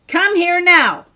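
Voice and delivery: loud, high, furious command; angry shout. A narrator's reading. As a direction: shout the order at full force. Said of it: "he called out angrily".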